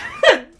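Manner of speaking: loud, very high burst of laughter; real high cackle; laughing. Real, not acted. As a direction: crack up helplessly. Cracks up over Kano.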